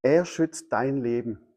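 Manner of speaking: slow, measured delivery; measured statement. Real, not acted. Preacher telling listeners of God's protection, slow and measured. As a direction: let each word land slowly. First take of key line.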